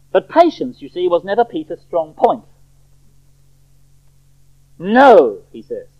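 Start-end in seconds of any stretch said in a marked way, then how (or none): 4.8-5.4 loud, flung-out "No"; forceful blunt objection; bold refusal. Acted. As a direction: refuse boldly at full voice. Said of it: "What a bold man to say that".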